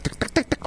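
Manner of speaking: voiceless "Tick, tick, tick, tick" like a rattling lid; soft clicking pot-lid imitation; ticking noise. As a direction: mimic the pot lid knocking.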